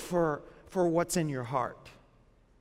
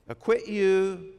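slow and drawn out; slow emphasis; slower than usual. Real, not acted. Preacher stressing the verse to the congregation, slowly. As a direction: linger on each word of it.

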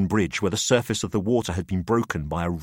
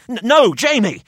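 high, loud stammering plea; urgent protest; 13 semitones higher, 8 dB louder than usual. A narrator's reading. Narrator begging Jamie again, loud stammering plea. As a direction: stumble then cry no.

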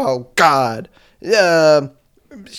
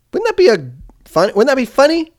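amused excitement; 11 semitones higher, 3 dB louder than usual. Real, not acted. Excited hockey trash-talk pitch.

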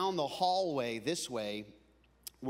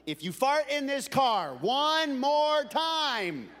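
slow, high, loud threat; imitating exasperated dad; 11 semitones higher, 8 dB louder, much slower than usual. Dad threatening kids in the car, loud exasperated warning. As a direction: stretch out each word at full exasperation.

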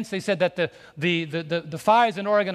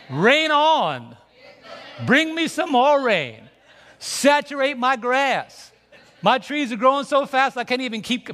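joyful preaching cry; 5 semitones higher, 3 dB louder than usual. Real, not acted. Preacher cries out for rain.

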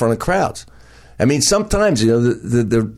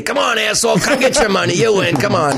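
club owner calling out; 8 semitones higher than usual. Retold or imitated. Club owner waving the money.

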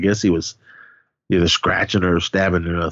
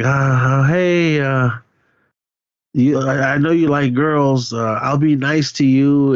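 higher-pitched, halting sweet talk; mock nervous coaxing; 5 semitones higher, slower than usual. Playing Liz placating Gunther with hidden dagger, mock nervous coaxing. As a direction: imitate someone stalling and buttering up a monster.

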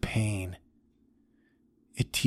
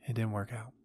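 much softer, trailing echo of the line; quiet repeat; 10 dB quieter, faster than usual. Acted. Old man repeating that his first love failed, much softer. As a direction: drop the repeat to almost nothing.

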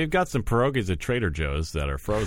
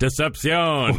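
slow, high, shouted gloat; shouted villain reveal; 10 semitones higher, 4 dB louder, slower than usual. Acted. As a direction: bellow it like a triumphant villain. Gloating reveal after the scam.

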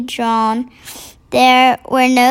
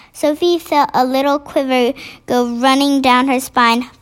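usual speaking voice

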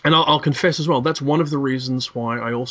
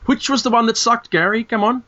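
teasing demand; 8 semitones higher than usual. Real, not acted. Teasing demand for the bad story.

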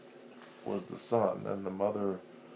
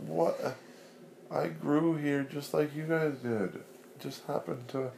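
hurt potato; 8 semitones higher, 3 dB louder than usual. Acted. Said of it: "He was like".

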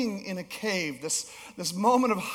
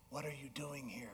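barely voiced, hushed question, much softer; quiet voice of God repeating the question; whisper of God; 7 semitones lower, 20 dB quieter than usual. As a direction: breathe it as a still small whisper. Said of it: "God's voice was in the whisper".

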